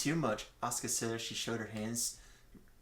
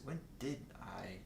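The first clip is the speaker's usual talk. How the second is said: unvoiced, soft confused whisper; whispered thought; 4 semitones lower, 14 dB quieter than usual. Jotaro wonders to himself, whispered. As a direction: hush it like a private worry.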